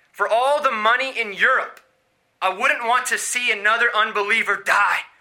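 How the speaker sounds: loud, high preaching delivery of the nurse's words; proclaimed quote. Retold or imitated. Preacher proclaiming the nurse's words at full force. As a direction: proclaim her words to the crowd. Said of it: "his nurse said".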